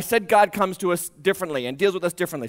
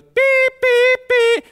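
high, loud, piercing beep beep like an alarm clock; 7 dB louder, much slower than usual. Retold or imitated. Preacher imitating God as an alarm clock, high loud beeps. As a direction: mimic an alarm going off, sharp and high. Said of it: "And then it goes".

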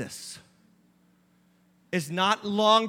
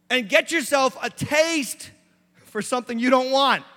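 loud, rising preacher's exhortation; full-force preaching; 5 semitones higher, 7 dB louder than usual. Acted. Pastor pleading with the congregation, loud and rising. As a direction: drive it home like a pulpit call.

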